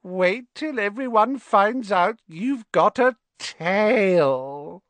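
enjoy the cruel joke; gleeful ghost. Acted. Myrtle jeers at Hermione as they leave, high and slow. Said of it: "with a hearty guffaw".

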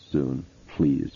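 soft, low, feeble plea; croaked plea. A narrator's reading. Exhausted mother begging for help soon, soft croak. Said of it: "she croaked".

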